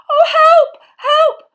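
high, loud, near-sobbing cries for help; frantic pleading. A narrator's reading. Hermione panicking by the tree, sobbing high cries. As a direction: beg in panic, voice cracking. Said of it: "Hermione whispered frantically".

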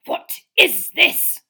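slow, loud, high-pitched outrage; furious growl. Acted. Uncle rounding on Harry, slow furious growl. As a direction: bite out each word in fury. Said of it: "growled Uncle Vernon".

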